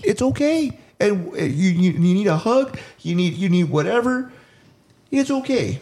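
holding back tears while reassuring listeners; overwhelmed, near tears. Real, not acted. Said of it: "I wanna lose my shit right now".

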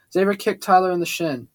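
ordinary delivery, nothing marked

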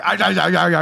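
high, loud mock British groan. Mocking how British people talk, loud British groaning. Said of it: "When British people are talking like".

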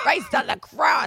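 drawn-out shouted curse; mock exclamation. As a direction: belt it out slowly. Exclamations contest.

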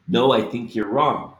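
ordinary talk

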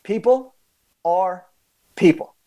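each word set apart by long pauses; slow emphatic point. Real, not acted. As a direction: land "People are people" with weight. Key message, spaced for weight.

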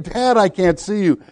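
high mock yell without much volume; retold boy's yell. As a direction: imitate a boy calling out, voice pitched high. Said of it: "And he yelled again".

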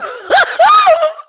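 high, loud wailing laugh; shrieking laugh. Real, not acted. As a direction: burst into a high-pitched cackle, almost crying. Reacting to the bruised ego talk.

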